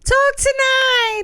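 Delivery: loud, high, long-held crowd yell; yelled song title. Pretend. Co-host answering the host's yell prompt, high drawn-out shout. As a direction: shout it high and stretch it out slowly. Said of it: "and you were to yell".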